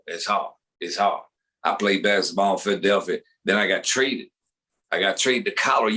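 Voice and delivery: low, repetitive griping player voice; deep casual lament, clipping the endings; Allen Iverson. Acted. Former Sixer recalling his trade, low put-on voice. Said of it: "Allen Iverson, former seventy-sixer".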